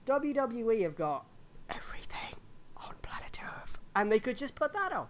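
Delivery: hushed, secretive whisper; conspiratorial whisper. Acted. Whispered aside.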